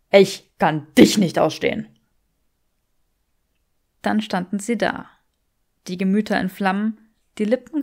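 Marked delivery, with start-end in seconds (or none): 0.1-1.9 man echoing her hatred back; Draco's hatred. Acted.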